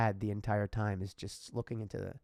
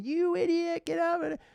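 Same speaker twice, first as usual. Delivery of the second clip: high-pitched, louder imitation of an irate driver; mock angry yell; 4 dB louder than usual. Imagined driver leaning out at a lost trailer driver, mock angry yell.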